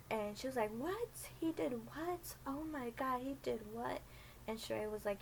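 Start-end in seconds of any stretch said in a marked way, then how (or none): 0.7-4.0 high, stunned what; high retold shock, falling back toward normal; shocked disbelief. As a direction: gasp it out in disbelief. Old lady shocked at news.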